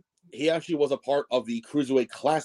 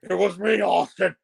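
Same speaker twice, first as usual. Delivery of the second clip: villain reveal voice; 6 semitones higher than usual. Pretend. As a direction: reveal yourself like a cartoon villain.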